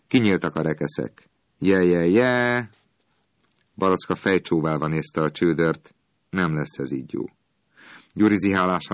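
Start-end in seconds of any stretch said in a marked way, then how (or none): 1.6-2.7 slow, stretched-out hawker's call, in an odd voice; drawn-out holler. A narrator's reading. Ice man calling his customers again, a slow holler. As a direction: call it out slowly. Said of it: "kurjantott egyet".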